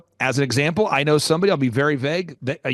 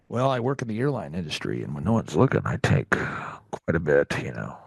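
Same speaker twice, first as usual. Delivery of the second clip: voicing a sly relative; 11 semitones lower than usual.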